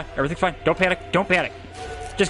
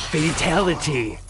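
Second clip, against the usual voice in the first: slow game-announcer call; Mortal Kombat; 5 semitones lower than usual. Acted. Gamer calling the boss's gory finish, announcer imitation. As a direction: draw it out like a fighting-game announcer. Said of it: "Mortal Kombat fatality".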